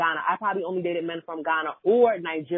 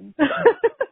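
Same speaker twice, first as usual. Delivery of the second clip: high burst of laughter; 7 dB louder than usual. Real, not acted. Host laughs at guest's story.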